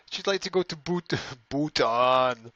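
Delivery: light put-on accent saying she'd like to go to Bhutan; strange accent. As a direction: play a put-on foreign accent for Bhut-, Bhutan. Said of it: "I said that in a strange accent".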